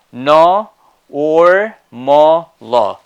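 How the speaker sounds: normal broken into loud syllables, with an R on "or". Shown as a demonstration. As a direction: sound out "no, or, mo, lo", stressing the R. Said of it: "And the next one is".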